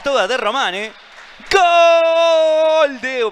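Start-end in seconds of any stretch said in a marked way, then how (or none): 1.5-3.3 high loud held goal shout; goal call. Real, not acted. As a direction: belt the goal out. Live goal call by commentator.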